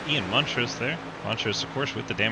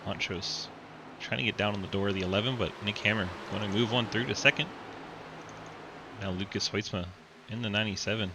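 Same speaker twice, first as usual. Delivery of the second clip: race commentary. Acted. Live race play-by-play.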